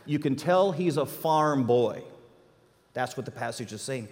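ordinary talk

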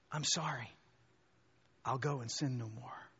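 soft, subdued apology; humble repentance. Acted. Sinner standing before Jesus, softly contrite. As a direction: confess it quietly.

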